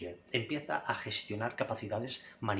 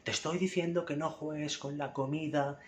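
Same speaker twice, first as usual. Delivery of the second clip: raised scolding tone; 6 semitones higher than usual. Shown as a demonstration. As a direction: pitch jumps up, mock scolding of a child. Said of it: "subir el tono y gritarle".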